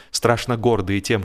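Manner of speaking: usual speaking voice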